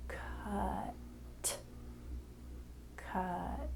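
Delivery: hushed blended word; soft blended word. Shown as a demonstration. Teacher softly revealing the blended word to the child. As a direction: whisper "cut" softly as one word. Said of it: "put those sounds together".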